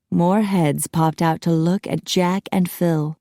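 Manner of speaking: plain reading voice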